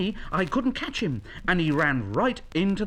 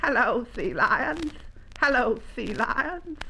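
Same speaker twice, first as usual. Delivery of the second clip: high, slow little greeting; shy young llama; 9 semitones higher than usual. Acted. Young llama greets the sea lions, high and slow. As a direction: call hello in a small high voice.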